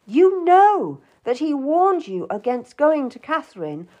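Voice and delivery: high, emphatic scolding; angry. A narrator's reading. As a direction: reproach her angrily, hammering each charge. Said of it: "he looked at me angrily".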